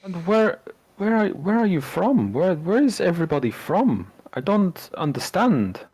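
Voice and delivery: high-pitched, swooping disbelief; acted astonishment, voice riding high; bewildered villager. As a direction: stammer out baffled questions. Villagers baffled by strangers' origins.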